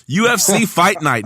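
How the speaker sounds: normal talking voice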